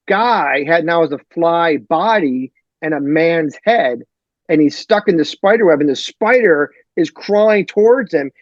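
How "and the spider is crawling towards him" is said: ordinary talk, nothing marked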